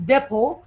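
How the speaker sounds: "depot" with the final T left off; silent T. Shown as a demonstration. Teacher repeats depot for students to copy, silent T. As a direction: end "depot" on the O sound, no T. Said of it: "T silent".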